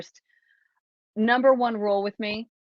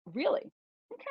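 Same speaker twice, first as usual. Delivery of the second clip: very soft, high-pitched disbelief; tiny, restrained reaction; silently fuming; 7 semitones higher, 10 dB quieter, faster than usual. Retold or imitated. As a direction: act out holding anger in, barely voiced. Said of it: "so mad that I didn't say I was mad".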